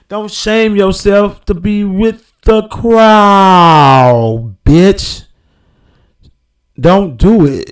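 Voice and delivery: slow, loud scolding sermon; preaching loud. Real, not acted. Preaching loud to the listener about the crowd.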